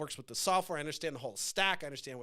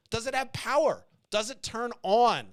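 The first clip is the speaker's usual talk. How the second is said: ordinary delivery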